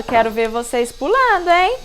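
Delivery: normal talking voice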